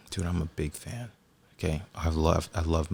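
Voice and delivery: retold whisper. Whispering to the comedian that he is a big fan, hushed. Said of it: "And then I whispered".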